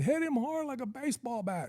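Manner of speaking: very high, soft strained mock holler; squeaky-high acted urging, held back in volume; imitating someone cracking up. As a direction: imitate a friend egging you on helplessly. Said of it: "he's laughing so flipping hard".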